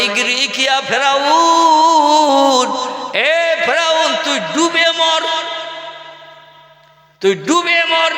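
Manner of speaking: high, slow, drawn-out curse; prophet's curse. Acted. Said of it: "মুসা বলে". (উঁচু গলায় টেনে টেনে অভিশাপ)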